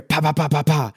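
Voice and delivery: rapid "pa-pa-pa" knocking noise; imitated door knocking. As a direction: mimic someone banging on a door. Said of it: "on my door".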